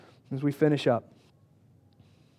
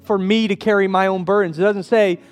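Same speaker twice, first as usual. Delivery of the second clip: raised, high, louder preaching; preaching at full force; 7 semitones higher, 5 dB louder than usual. Acted. Pastor urging his congregation, impassioned preaching. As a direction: drive the point home from the pulpit.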